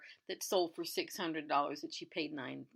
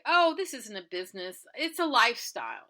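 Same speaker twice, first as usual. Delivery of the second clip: loud, proud comeback; defiant retort; 3 semitones higher, 7 dB louder than usual. Retold or imitated. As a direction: throw it back at him with pride. Retold proud retort to owner.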